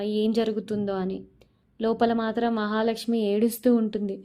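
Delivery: plain reading voice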